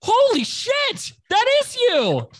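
slow, very high, loud disbelief; mock-shout it like a stunned fan; imitating someone gobsmacked at recognizing you; starstruck astonishment. Acting man recognizing him.

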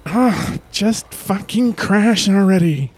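irritated. Acted. Angry at phone.

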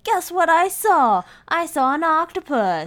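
high, loud, hammed-up bragging; mock excited boasting. Teasing the diver with a pretend sighting, loud mock excitement. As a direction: crow about it in an exaggerated excited voice.

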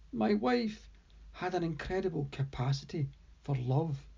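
ordinary delivery

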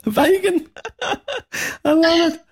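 high, delighted exclamation with a laugh; amused, laughing delight at the vegan joke. Real, not acted. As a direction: react with real delight and laughter. Host delighted by story.

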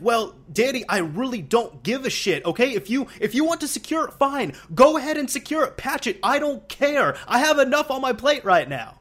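high-pitched imitation of an angry teammate; retold yelling. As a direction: act out his fed-up rant, pitch jumping up. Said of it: "yelled at me like that".

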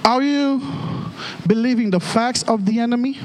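ordinary talk

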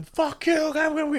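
high mock argument; imitating angry yelling. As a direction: act out a call-centre row. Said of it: "you would hear them".

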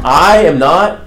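"I" stretched and lifted above "am not"; stress on I. Retold or imitated. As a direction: hit "I" hard to point away from yourself. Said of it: "this puts the emphasis on I".

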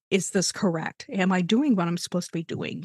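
usual speaking voice

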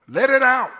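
very loud, high rallying cry; urging shout. Real, not acted. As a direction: belt it out to the whole church. Urges congregation to let it out.

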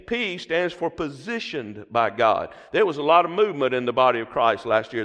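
usual speaking voice

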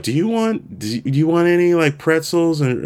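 high mock-polite attendant voice; flight attendant. Flight attendant offering pretzels to a woozy passenger, high mock voice.

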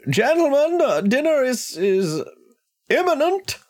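very high, prim butler announcement. Acted. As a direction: announce dinner in a high fussy voice. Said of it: "Augustus at the foot of the stairs again saying".

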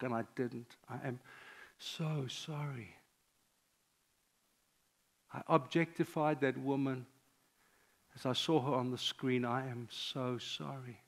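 sorry, so sorry, barely above a breath; hushed confession. Shown as a demonstration. Pastor voicing a penitent's prayer, hushed and contrite.

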